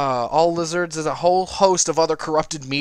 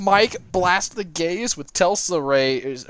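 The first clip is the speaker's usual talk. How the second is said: ordinary talk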